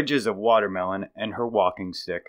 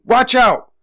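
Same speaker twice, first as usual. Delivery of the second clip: plain reading voice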